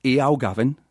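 Geordie "Eow, Gavin" with a rising "Eow"; Geordie accent. Shown as a demonstration. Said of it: "the little phrase is".

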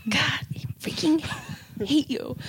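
harsh whisper, no voice. Real, not acted. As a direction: hiss it under the breath. Mock outburst at friend.

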